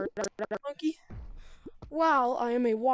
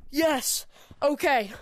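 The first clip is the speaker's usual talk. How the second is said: loud, higher, buzzing with relief; excited relief; 3 semitones higher, 6 dB louder, much slower than usual. Real, not acted. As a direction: cheer it out, still pumped from the game. Relief at surviving the hunt.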